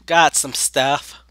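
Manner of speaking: ordinary talk, nothing marked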